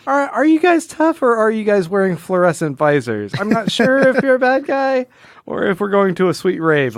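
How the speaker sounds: puzzled, teasing high voice; mock puzzlement. Mock doubt at comic villains.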